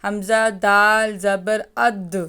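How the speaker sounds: اد with the دال bounced and echoed at the end; teacher sounding اد with a clear qalqala bounce; qalqala demo. Shown as a demonstration. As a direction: drill اد slowly, letting the دال rebound. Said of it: "یوں پڑھیے گا".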